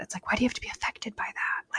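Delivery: a hushed, exasperated whisper; whispered aside. Retold or imitated. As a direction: mutter the question like a secret. Frustrated rhetorical aside whispered.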